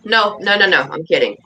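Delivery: ordinary delivery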